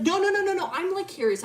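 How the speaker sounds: high, loud string of no's; frantic rambling. Retold or imitated. Milo defending the question to Ellie, frantic and excited. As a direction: rush in high to explain yourself. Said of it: "he's not talking normally".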